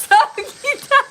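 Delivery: name bursts out high and loud through laughter; can't hold the laugh in, shrieking it out. Real, not acted. Streamer to chat, blurting the name while laughing. Cracking up at a picture.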